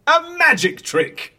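high, drawn-out showman's reveal; grand announcement. Acted. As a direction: unveil it like a stage magician.

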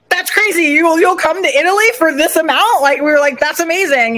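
high, thrilled disbelief at the price; amazed disbelief. Retold or imitated. Couple reacting to the photographer's fee, high and amazed. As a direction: gasp it out, you can't believe the offer.

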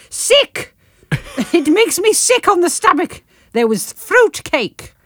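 high, laughing outburst on Sick, then slow; excited exclamation. Shown as a demonstration. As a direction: burst out with it, half laughing. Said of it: "This is called Hello and Goodbye".

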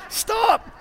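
high laughing stop. Real, not acted. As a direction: giggle out the word stop. Laughing at own brain.

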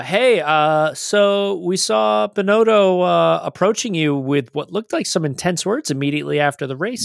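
eager reporter. Retold or imitated. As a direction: play a keen TV interviewer asking probing questions.

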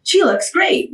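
high-pitched admiring onlooker; imitated compliment. Onlooker seeing the patient, high mock admiration. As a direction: gush lightly as an outside observer.

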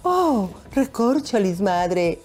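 melodramatic dubbed-soap line; hammy stage actor. Pretend. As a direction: overplay it like a cheesy dub. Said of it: "esas traducciones del Canal cinco".

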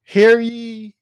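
loud, high proclamation call; town crier. Acted. Mock town crier opening.

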